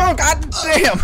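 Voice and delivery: high loud cursing yell; startled shout. Real, not acted. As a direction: shout it in sudden shock. Jump scare in game.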